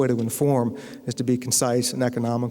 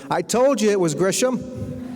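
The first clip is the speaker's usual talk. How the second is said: high, slow call across the restaurant; yelling across a room; 7 semitones higher, slower than usual. Retold or imitated. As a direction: shout it to her husband far away. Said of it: "she yells across the restaurant".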